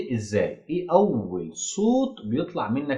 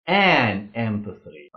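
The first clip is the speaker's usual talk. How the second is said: slow model answer; much slower than usual. Shown as a demonstration. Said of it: "يبقى كده هقول إيه".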